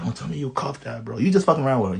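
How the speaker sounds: ordinary talk, nothing marked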